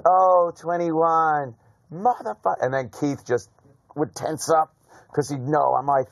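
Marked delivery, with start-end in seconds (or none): 0.1-2.6 slow groaned-out swear, rising high; retold exasperated curse. Gambler seeing the dealer make twenty-one, exasperated curse. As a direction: stretch it out, ending high in mock disgust.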